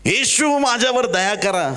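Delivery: high, insistent plea; crying out. Acted. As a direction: blind man calling for mercy over the crowd. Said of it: "तो ओरडणार".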